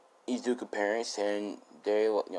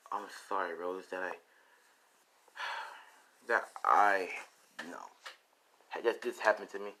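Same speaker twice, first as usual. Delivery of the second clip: much softer, sighing sorry; soft apology; 2 semitones lower, 9 dB quieter than usual. Retold or imitated. Boy apologizing to Rose, soft and sighing.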